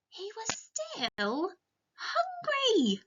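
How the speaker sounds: rising up high and slow on hungry; playful high refrain. Acted. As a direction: squeak out the last word for kids.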